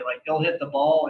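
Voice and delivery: normal talking voice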